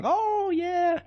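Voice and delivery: very high, squeaky little ant voice; tiny ant voice. Acted. Tiny ants answering the raccoon in squeaky little voices.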